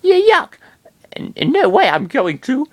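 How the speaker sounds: very high, loud stammering shriek; disgusted scream. Acted. Girl backing away from the rat, high stammering shriek. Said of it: "she screamed as she backed away".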